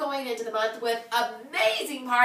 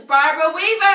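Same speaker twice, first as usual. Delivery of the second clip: loud, higher winner call; excited shout; 5 semitones higher, 10 dB louder than usual. Real, not acted. Announcing Barbara Weaver as a winner, loud excited call. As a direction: call the name out to the crowd.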